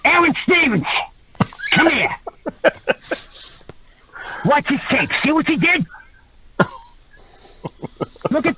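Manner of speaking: high, mocking trainer pointing out; imitating Mike. Trainer making the wrestlers watch the injury tape, high and mocking. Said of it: "Mike's like".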